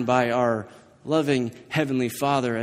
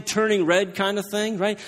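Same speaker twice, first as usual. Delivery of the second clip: usual speaking voice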